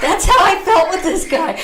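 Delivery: high, loud laughing line; loud laughing aside. Real, not acted. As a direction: laugh through the words. Laughing remark.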